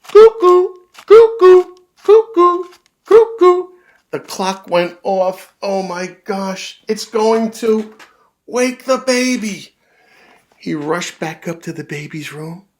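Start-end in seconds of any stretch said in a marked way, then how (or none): 0.0-3.7 high loud cuckoo calls; cuckoo clock call. Acted. Cuckoo clock going off, high and loud. 5.6-9.7 higher, louder, slow panic; panicked dad. Acted. Dad panicking that the clock will wake the baby.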